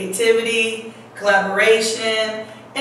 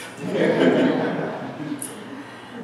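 quiet laugh; 4 dB quieter than usual. Real, not acted. Laugh at adult business.

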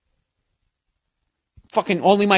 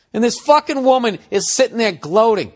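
ordinary talk, nothing marked

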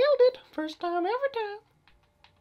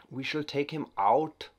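slow, higher mock German accent; weird German voice; 5 semitones higher, 6 dB louder than usual. Gamer plotting a takedown in mock German accent. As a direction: drawl the threat in a hammed-up German accent. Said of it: "that weird German voice".

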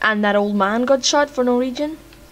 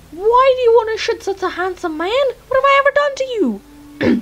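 high-pitched, wounded disbelief; indignant protest; 12 semitones higher, 4 dB louder than usual. Real, not acted. Protesting being shot in game.

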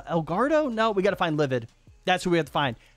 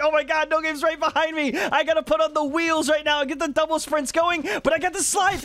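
a lightly voiced panicked player, higher; mock panic, raised a bit in pitch; imitating a rattled opponent with a hint of higher-pitched panic; freaking out; 7 semitones higher than usual. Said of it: "he's freaking out right now".

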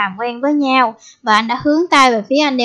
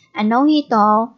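normal talking voice

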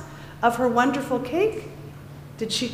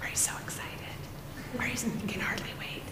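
whisper; 15 dB quieter, faster than usual. Real, not acted. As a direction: whisper to calm them down. Teacher hushing excited kids.

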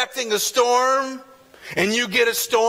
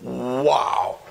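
awed wow; 10 semitones lower than usual. Retold or imitated. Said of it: "And they're like".

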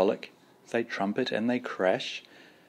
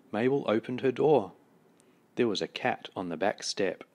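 usual speaking voice

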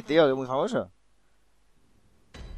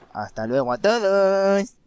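high, slow mock sign-off; put-on goodbye voice; 7 semitones higher, 6 dB louder, much slower than usual. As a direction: sing out a slow high farewell. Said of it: "No pongas esa voz".

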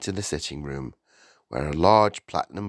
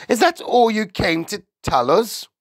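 high, loud, irritated demand; cross, high-pitched wife; ratty; 7 dB louder than usual. A narrator's reading. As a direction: snap at the unwelcome visitor. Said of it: "ratty about missing her programme".

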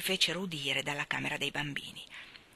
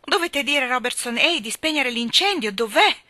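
loud, high-pitched urgent demand; urgent outcry; 6 semitones higher, 8 dB louder than usual. A narrator's reading. Mrs Banks sending the cook to get the fire put out, urgent outcry. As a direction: raise your voice in alarm.

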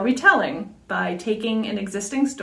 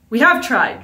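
louder, sharp comeback; stung, snap back at her; a stung apprentice snaps back, louder; snapped back; 4 semitones higher, 7 dB louder than usual. Acted. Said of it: "she snapped back".

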